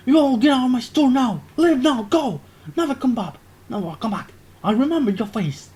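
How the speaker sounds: high, loud enraged shopkeeper; furious shout. Acted. The shopkeeper throws the robbers out, furious shouting. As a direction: roar them out of the store. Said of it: "the shopkeeper shouts".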